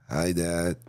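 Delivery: comically deep, low greeting; deep mock-masculine hello; daughter turned boy. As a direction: drop it low like a voice changed by steroids. Daughter's voice dropped after steroids.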